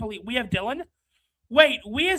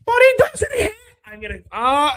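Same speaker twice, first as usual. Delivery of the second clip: half-sob the complaint; real frustrated cry-shout; 6 dB louder than usual. Real, not acted. Fan breaks down over signing.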